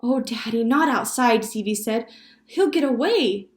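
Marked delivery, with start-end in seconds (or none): 0.0-1.4 put-on high, loud child's protest; pleading boy. A narrator's reading. Stevie begging Daddy about the frog, pleading. 2.4-3.5 high, loud worried protest; pleading boy. A narrator's reading. Stevie fearing frog will escape, pleading.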